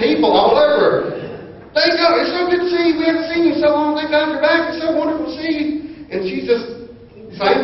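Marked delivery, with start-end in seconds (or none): 1.7-5.7 high, effusive gushing; gushing reunion. Retold or imitated. Church members gushing over his returning wife. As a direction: gush over a long-lost friend.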